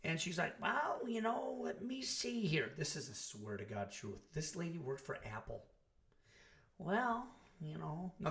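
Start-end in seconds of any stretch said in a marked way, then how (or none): 0.6-2.7 put-on high, slow, dithering old-lady voice. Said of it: "some old lady going". 6.8-8.1 lightly voiced dithering old lady. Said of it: "some old lady going".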